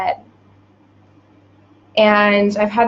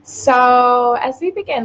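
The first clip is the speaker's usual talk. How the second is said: ordinary talk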